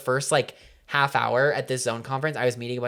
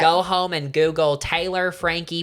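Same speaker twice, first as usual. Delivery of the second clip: higher, louder hammed-up Southern belle; mock Southern accent; 7 semitones higher, 5 dB louder than usual. Host shooing listeners to Google gossip in Southern accent. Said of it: "My accent is getting so".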